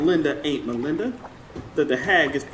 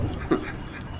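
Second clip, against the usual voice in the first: breathy unvoiced laugh; 9 dB quieter than usual. Old man laughs about his dog.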